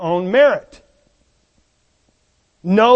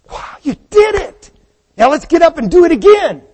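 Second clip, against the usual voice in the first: put-on high, delighted praise to a child; dad clapping for his child after the fall, excited; cheering parent; 7 semitones higher, 6 dB louder than usual. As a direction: cheer them on like a proud dad. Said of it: "the dad's clapping".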